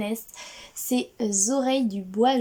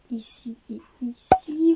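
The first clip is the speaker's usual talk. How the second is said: whisper. Real, not acted. Booktuber pointing viewers to the books, whispered aside. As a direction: whisper it like a little secret.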